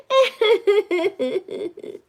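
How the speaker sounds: high rippling giggle; teasing giggle. Real, not acted. Streamer teasing Tamelia about Mommy, giggling. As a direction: giggle after the tease.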